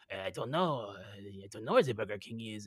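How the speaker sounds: put-on low mumbled French accent. French locals answering a tourist, low French accent. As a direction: shrug it off in mock French. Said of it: "And they're like".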